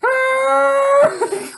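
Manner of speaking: very high, loud cartoon wail; hammed-up howl of loss; mock anguished cry. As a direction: shriek it like the text spells it. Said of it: "It's literally what it is".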